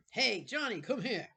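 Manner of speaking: loud, high bully calling across the room; Sebastian calling out. Acted. Sebastian calling Jonathan across the room, loud bully call. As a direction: shout him over like the gang leader. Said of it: "wrong voice".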